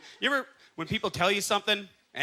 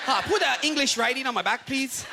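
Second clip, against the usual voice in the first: role; 6 semitones higher than usual. Retold or imitated. Chinese customer voiced at tattoo parlor.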